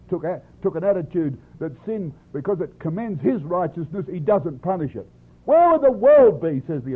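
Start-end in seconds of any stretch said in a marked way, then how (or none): 5.4-6.6 high, loud preacher's challenge; raised voice, quoting an indignant apostle; indignant rhetorical question. Acted. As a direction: throw it out as an exasperated challenge.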